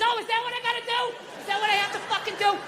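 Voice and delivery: high, mock-furious complaint without extra volume; lightly acted fit of frustration; retold exasperated scream. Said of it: "Don't scream like that".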